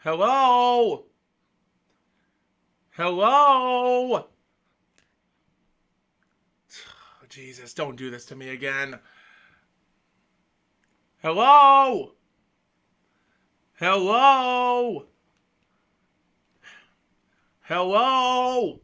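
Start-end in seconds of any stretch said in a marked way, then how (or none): 0.0-4.2 loud, high drawn-out hello; calling out. Real, not acted. Frozen camera, calling out to listeners, loud. 11.2-18.8 loud, high drawn-out hello; calling out. Real, not acted. Frozen camera, calling out to listeners, loud.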